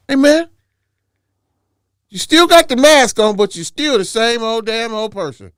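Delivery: loud, high teasing call. Acted. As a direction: call him out loudly. Loud calling out.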